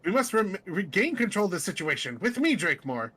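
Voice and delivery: lightly voiced pompous leader; rally your companion officiously; commanding seer.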